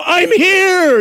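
loud, high proclamation; mock booming God; mock divine shout. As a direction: announce yourself like God. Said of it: "God would just show up and go".

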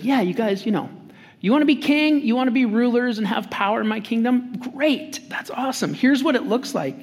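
high-pitched mock enthusiasm with sarcasm underneath; sarcastic. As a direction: feign being impressed, pitch lifted. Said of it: "so sarcastic in this moment".

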